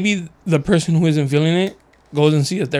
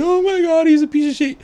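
put-on girly voice; 12 semitones higher than usual. Mimics a girl complaining to therapist.

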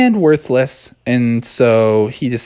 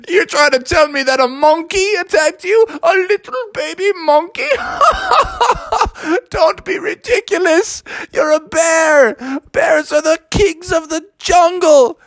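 dad bear scolding his son, much higher; big bear dad. Acted. The dad bear tells off Bubby on the floor, scolding.

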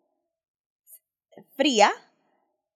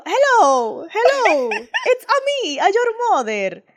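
high, louder singsong Mario greeting; mock Mario voice; 9 semitones higher, 6 dB louder, much slower than usual. Mother pranking her son on the phone as Mario. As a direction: ham it up down the line. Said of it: "Y yo".

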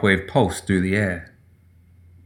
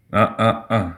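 mocking. A narrator's reading. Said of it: "said mockingly".

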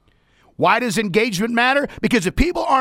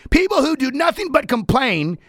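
ordinary talk, nothing marked

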